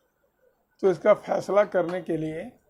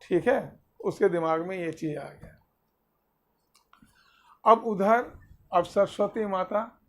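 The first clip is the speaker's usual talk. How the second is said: usual speaking voice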